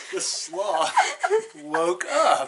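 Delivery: laughing through the words, loud and high. Real, not acted. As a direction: giggle out the waking. Laughs while reading.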